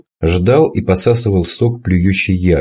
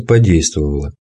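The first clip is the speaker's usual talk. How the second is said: usual speaking voice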